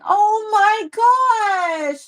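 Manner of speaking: high, stretched oh my gosh; touched by the comment; delighted gasp. Real, not acted. Host reacts to a viewer's long marriage, high delighted gasp.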